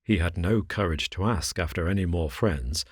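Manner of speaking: ordinary delivery, nothing marked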